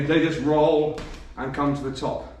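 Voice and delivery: normal talking voice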